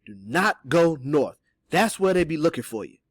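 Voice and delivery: slow, loud, separated words; deliberate, emphatic warning; clear warning. A narrator's reading. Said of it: "Cato answered f-, clearly".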